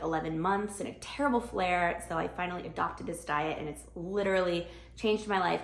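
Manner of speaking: normal talking voice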